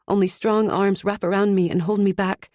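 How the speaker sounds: plain reading voice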